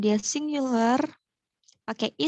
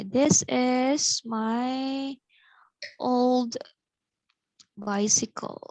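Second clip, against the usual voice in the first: slow model reading; much slower than usual. Shown as a demonstration. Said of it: "teacher models sentence slowly".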